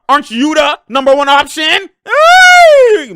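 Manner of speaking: loud, high yell rising to a shriek; exasperated shouting. Real, not acted. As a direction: build from loud disbelief to a screamed hey. Outraged at a bad play.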